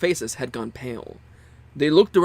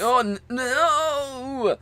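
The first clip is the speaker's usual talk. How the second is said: flustered; 11 semitones higher, much slower than usual. Acted. Junpei flustered by remark.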